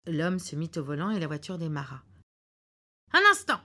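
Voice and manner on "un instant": much higher and louder; husband's sudden "Un instant", high and loud; sudden halt. Acted. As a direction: cry out to stop the car. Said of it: "dit alors monsieur Foster".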